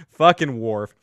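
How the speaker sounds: normal talking voice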